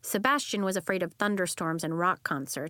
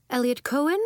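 louder name call; 5 semitones higher, 6 dB louder than usual. Acted. Official calls a name.

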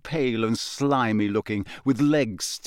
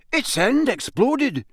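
angry; 13 semitones higher, slower than usual. Acted. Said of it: "said Dean angrily".

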